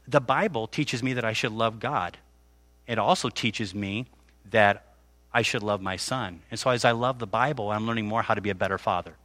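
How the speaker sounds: soft, gentle, measured reply; calm answer. Retold or imitated. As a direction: explain it kindly to a child. Calm careful answer to child.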